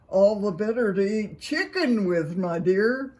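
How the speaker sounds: a hint of a wolf posing as grandma; wolf playing Granny. A narrator's reading. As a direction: bluff it as sweet old Granny.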